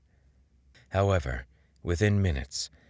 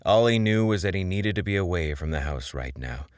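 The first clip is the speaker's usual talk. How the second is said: usual speaking voice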